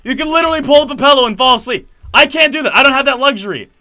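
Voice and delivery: high, loud, indignant complaint. Retold or imitated. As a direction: lay into the seatmate, voice climbing. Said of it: "raising my voice at this point".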